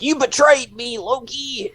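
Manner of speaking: high, indignant accusing voice; mock betrayed Mobius. Friend imitates Mobius accusing Loki, high and indignant. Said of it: "he's like".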